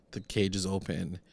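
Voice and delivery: usual speaking voice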